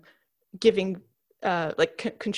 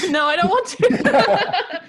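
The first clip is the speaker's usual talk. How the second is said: laughing; 11 semitones higher, 6 dB louder, slower than usual. Real, not acted. Embarrassed, asked to repeat word.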